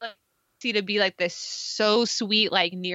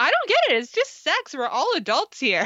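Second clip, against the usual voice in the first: high mocking imitation; 7 semitones higher than usual. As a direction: squeak it out mockingly. Mocking Anna's line.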